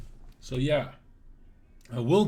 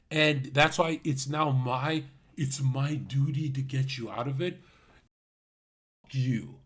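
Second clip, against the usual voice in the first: ordinary talk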